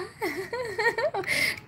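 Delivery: ऊँची खिलखिलाती हँसी; छोटी सी ऊँची हँसी; high laugh. Real, not acted. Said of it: "[name] बहन हंस रही हैं". (ज़ोर से खिलखिलाकर हँसना)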